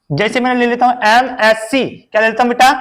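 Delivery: ordinary talk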